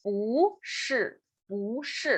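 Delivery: "bu" rising, "shi" falling; second tone on bu. Shown as a demonstration. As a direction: lift "bu", then let "shi" drop. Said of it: "se convierte en el segundo tono".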